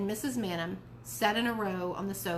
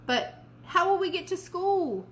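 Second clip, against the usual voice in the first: pouting; 9 semitones higher, 4 dB louder than usual. A narrator's reading.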